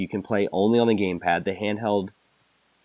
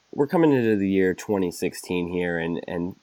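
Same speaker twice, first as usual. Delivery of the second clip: ordinary talk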